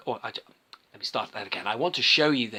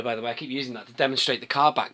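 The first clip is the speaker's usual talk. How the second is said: ordinary delivery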